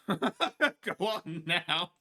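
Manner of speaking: very slow, loud, laughing go on; laughing shoo. Real, not acted. Shooing the figure away, laughing loudly. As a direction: drag it out while laughing.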